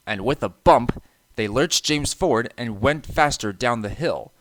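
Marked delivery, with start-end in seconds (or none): none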